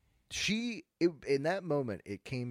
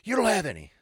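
loud, higher accusing customer; annoyed customer; 7 semitones higher, 10 dB louder than usual. Retold or imitated. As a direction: throw it at the clerk like an accusation. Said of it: "She, she comes back".

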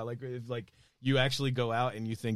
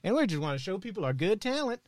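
hayseed character; 9 semitones higher than usual. Retold or imitated. Comedian replays their stage bit as eager hayseeds. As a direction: play the naive rube from the sticks. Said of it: "hayseed characters".

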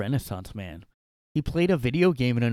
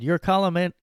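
raised, higher voice, defending hard; loud mock indignation; 7 semitones higher, 7 dB louder than usual. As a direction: read it like an offended fan. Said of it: "And then Jay responded".

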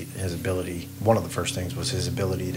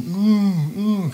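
high, wordless worried hum; imitating his father; 10 semitones higher, 3 dB louder than usual. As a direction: hum like a man who can't talk. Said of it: "he's going".

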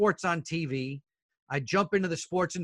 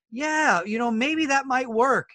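excited younger self; 8 semitones higher, slower than usual. Retold or imitated. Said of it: "in my mind, I went back".

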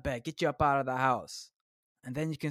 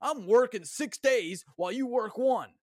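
mocking hustle guru; 7 semitones higher, 3 dB louder than usual. Mocking a rich guru bragging about working more, higher smug voice. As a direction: gloat like a self-satisfied grinder. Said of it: "And then they go on".

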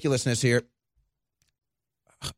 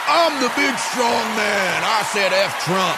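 mocking Robert De Niro; 6 semitones higher than usual. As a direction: puff up like a blustering Hollywood star. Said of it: "He just goes".

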